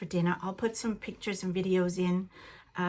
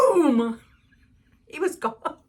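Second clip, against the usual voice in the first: loud boom; 6 semitones higher, 8 dB louder, slower than usual. Real, not acted. Telling how the dog fled.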